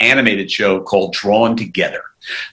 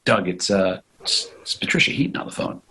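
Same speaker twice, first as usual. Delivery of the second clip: hushed, nearly whispered phone announcement; imitated sister; 4 semitones lower, 9 dB quieter than usual. Sister announcing who is on the phone, imitated hush. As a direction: drop to an uneasy hush, with hesitations. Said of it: "my sister comes in. She goes".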